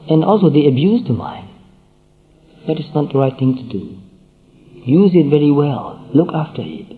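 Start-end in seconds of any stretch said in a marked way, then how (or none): none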